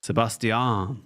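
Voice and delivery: "Sebastian" slow, every syllable, se-bas-ti-an; drawn-out "Sebastian" ending on a clear "an"; careful se-bas-ti-an. Retold or imitated. Caster tries the foreign se-bas-ti-an slowly. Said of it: "That feels so weird though".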